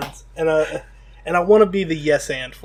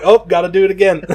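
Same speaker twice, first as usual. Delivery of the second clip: louder, laughing through the line; laughing mock doctor; 3 semitones higher, 9 dB louder than usual. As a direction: gleefully fake regret. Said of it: "I'm like".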